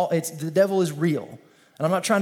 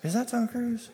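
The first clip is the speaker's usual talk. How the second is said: gossiping onlooker; 4 semitones higher, 4 dB quieter than usual. Retold or imitated. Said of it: "you're kinda like".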